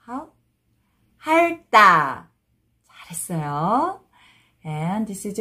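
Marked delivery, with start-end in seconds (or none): 1.2-2.3 핥다 run together as 할따, tense tt; read together. Shown as a demonstration. Teacher shows how it reads together, linked pronunciation. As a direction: join 핥다 into one word with a hard 따. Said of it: "When you read it together".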